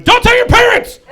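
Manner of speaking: very high, loud blurt; hammed-up frantic warning; panicked shout. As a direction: yell it in comic panic. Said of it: "tried to fix that by going".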